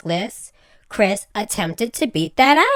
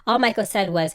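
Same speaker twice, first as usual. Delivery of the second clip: ordinary talk, nothing marked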